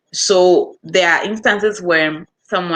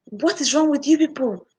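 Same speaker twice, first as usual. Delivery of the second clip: higher-pitched imitation of a shouting caller; mock angry customer; 9 semitones higher than usual. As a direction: play the irate customer, pitched up. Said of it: "the person is shouting".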